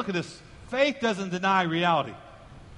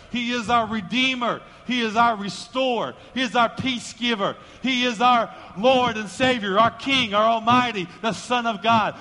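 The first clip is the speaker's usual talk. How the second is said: rising, louder litany, punched phrases; full-force preaching; 3 semitones higher, 5 dB louder than usual. Real, not acted. Preacher listing who God is to the congregation, full-force preaching. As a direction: hammer out each title in rhythm.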